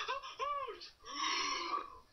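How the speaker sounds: mock sneeze build-up; sneezy bear. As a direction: huff it like a bear about to sneeze. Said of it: "the bear went".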